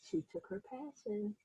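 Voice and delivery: put-on gentle read-aloud narration for children; teacher reading a picture book aloud; story narration. A narrator's reading. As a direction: tell it like a bedtime storybook.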